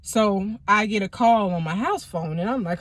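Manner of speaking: usual speaking voice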